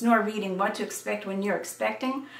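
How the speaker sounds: ordinary delivery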